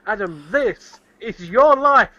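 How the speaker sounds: slow, hammed-up host announcement; mock presenter reveal; game show host. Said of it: "all I'm gonna do is".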